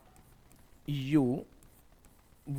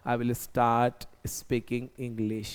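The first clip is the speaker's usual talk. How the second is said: very slow, each word laid out for the class; slow model sentence; 5 semitones lower, 4 dB quieter, much slower than usual. Shown as a demonstration. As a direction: dictate it slowly like a teacher. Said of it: "अब मैं English बोलना start करूंगा". (बहुत धीरे, साफ़-साफ़)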